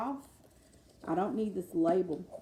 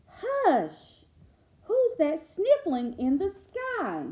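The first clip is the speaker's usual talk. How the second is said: storybook reading; 9 semitones higher, 8 dB louder, much slower than usual. Acted. Reading the book aloud.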